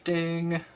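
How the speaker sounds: high soft chime; ding sound effect. Pretend. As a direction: sing it like a game chime.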